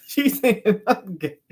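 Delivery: high, slow words through laughter; real laughter breaking up the sentence; laughing. Real, not acted. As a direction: crack up while saying it. Laughs at his own predicament.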